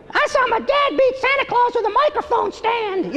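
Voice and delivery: put-on very high, breathy little-boy voice. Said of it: "my funny little [name] impression".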